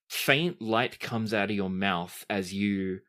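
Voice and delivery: usual speaking voice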